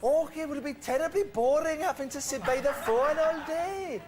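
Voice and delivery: high, slow, hammed-up Scottish accent. Shown as a demonstration. Host defends his accent to teasing co-hosts, exaggerated Scottish accent. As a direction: overdo the Scottish accent to prove it works. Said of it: "spot on Scottish accent".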